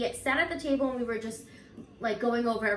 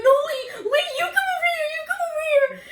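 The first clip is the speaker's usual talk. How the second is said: high, loud frightened pleading; panicked plea; 7 dB louder, faster than usual. Retold or imitated. Retelling the haunting to friends, high panicked pleading. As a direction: beg her to come over, terrified. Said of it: "And Carissa's like".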